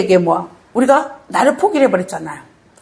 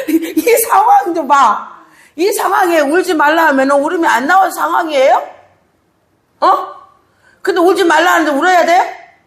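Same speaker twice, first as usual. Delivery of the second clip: impassioned pulpit preaching, higher and pressing; preaching at full force; 7 semitones higher than usual. Acted. Pastor urging the congregation in full preaching voice. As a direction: drive the point home to the congregation.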